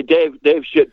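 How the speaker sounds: ordinary delivery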